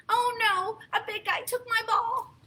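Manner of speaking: high, whiny little-pig voice; a small pig wailing about her lost ball; upset Piggy. Acted. Piggy telling her friend her ball was taken, high and upset.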